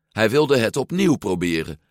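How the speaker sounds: ordinary delivery, nothing marked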